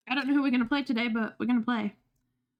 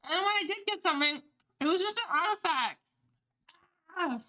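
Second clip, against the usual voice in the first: talking while chewing food; words around a mouthful; mouth full; 5 semitones higher than usual. Real, not acted. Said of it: "sorry, I'm still eating".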